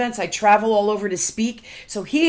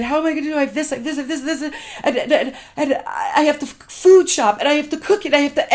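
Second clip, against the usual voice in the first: higher, stammering mock meltdown; retold overwhelmed panic; 6 semitones higher, faster than usual. Wife to husband after bursting into tears, acted-out overwhelm. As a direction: imitate tripping over a mounting list, can't breathe. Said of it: "like, I couldn't breathe".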